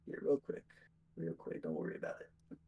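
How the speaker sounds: whispered under the breath, barely audible; muttered aside. Real, not acted. Streamer searching for the mod name mutters to himself in a whisper. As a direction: mumble it to yourself, not to the guests.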